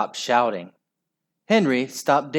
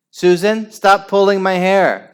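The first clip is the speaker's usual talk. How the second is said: high, louder squeal; 7 semitones higher, 9 dB louder than usual. Acted. Vain Violet complaining about her hair, squealing. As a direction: squeal like a prissy girl. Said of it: "squealed Vain Violet".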